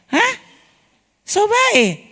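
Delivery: high, startled echo of the inner voice; surprised question. Retold or imitated. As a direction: gasp it out in disbelief. (bertanya kaget dengan suara tinggi)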